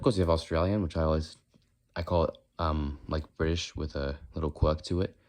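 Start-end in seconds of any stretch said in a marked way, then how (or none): none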